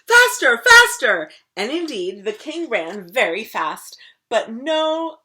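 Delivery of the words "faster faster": very loud high shout. Acted. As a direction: chase him with a yell.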